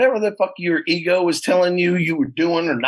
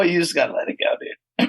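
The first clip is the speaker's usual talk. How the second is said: ordinary talk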